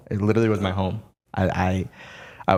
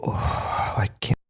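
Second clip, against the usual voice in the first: exasperated sigh; 3 dB quieter, much slower than usual. Retold or imitated. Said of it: "It's the eye roll".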